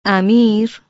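mother protests at her son, loud and reproachful; protesting. A narrator's reading. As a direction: scold him in one word. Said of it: "مادر به اعتراض گفت".

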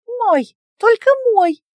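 high, loud little-creature shout of ownership; loud possessive cry. A narrator's reading. Little pet creature loudly claims the man as its own, high loud shout. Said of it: "раздалось громкое".

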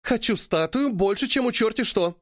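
high excited exclamation; exclaiming. A narrator's reading. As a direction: blurt it out eagerly, high and bright. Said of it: "восклицает богиня красоты".

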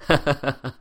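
short, cold sneering laugh. A narrator's reading. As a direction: laugh at her cruelly. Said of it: "Bá Nam cười lạnh".

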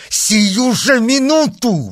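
loud, high, drawn-out demand; enraged insistence, voice shooting up; furious exclamation. A narrator's reading. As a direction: cry it out slowly, at full pitch. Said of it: "воскликнул герцог".

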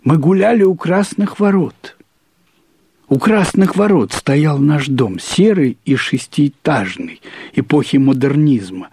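ordinary delivery, nothing marked

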